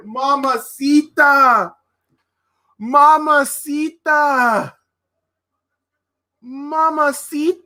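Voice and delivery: high, slow, drawn-out call of mamacita; Eddie Guerrero catchphrase. Retold or imitated. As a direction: stretch it out like a wrestler's catchphrase.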